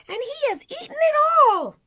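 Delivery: put-on very high, loud baby bear wail; outraged squeal as Baby Bear; wee little baby voice. A narrator's reading. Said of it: "in his wee little baby voice".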